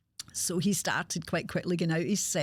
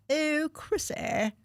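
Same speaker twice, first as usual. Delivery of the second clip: much higher, slow, plummy upper-class drawl; posh Catherine voice; 10 semitones higher, much slower than usual. Retold or imitated. Mimicking her posh workmate greeting her, high and drawn out. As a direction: stretch it out like a debutante greeting a friend. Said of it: "that's how she spoke".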